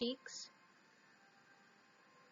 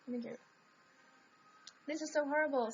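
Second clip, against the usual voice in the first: ordinary talk, nothing marked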